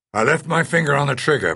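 ordinary delivery, nothing marked

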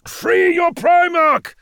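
high, drawn-out battlefield shout; full-throated commanding yell; urgent shout. A narrator's reading. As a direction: bellow the order over a storm. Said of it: "Guilliman shouted".

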